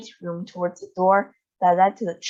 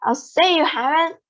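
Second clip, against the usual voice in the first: cried; 8 semitones higher, 3 dB louder than usual. A narrator's reading. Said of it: "cried Missus Beauregard".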